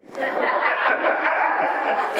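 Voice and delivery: a long real laugh at the memory; laughing out loud. Real, not acted. Preacher laughing at his own story. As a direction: burst out laughing, breathy and loud.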